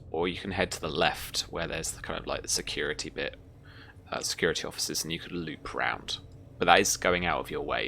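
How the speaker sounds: ordinary talk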